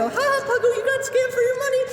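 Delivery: very high squeaky taunt; high-pitched mocking voice; taunting bootlegs. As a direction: mimic bullies in a high squeal. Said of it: "They always say".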